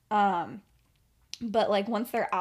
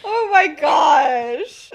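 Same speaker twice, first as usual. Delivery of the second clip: loud slow exclaim; 7 semitones higher, 14 dB louder, much slower than usual. Real, not acted. Reacting to co-host's joke.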